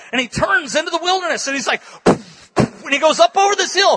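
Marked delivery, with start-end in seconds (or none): 2.0-2.8 loud puffed drum hits; imitated drum beats. Preacher imitates warrior drumming into the wilderness, imitated drum. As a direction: blow out two hard drum strokes.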